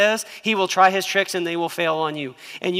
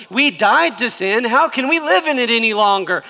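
indignant Paul; 5 semitones higher, 6 dB louder than usual. Retold or imitated. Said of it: "He goes".

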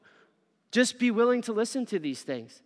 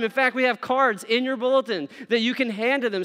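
ordinary delivery

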